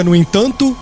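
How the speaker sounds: ordinary delivery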